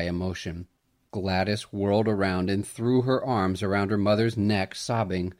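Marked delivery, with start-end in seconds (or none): none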